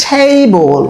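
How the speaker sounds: ordinary talk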